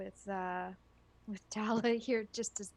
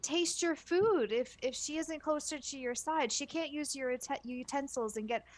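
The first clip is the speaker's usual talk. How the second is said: high wheedling voice; 10 semitones higher than usual. Acted. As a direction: sweet-talk the ogre. Character persuading ettin, high voice.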